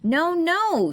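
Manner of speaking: high, loud yelled no; girl yelling at her parrot. Acted. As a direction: yell at the bird. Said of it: "she yelled".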